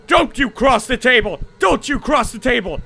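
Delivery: loud desperate command; shouting. Real, not acted. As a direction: yell a warning at it. Yelling at monster.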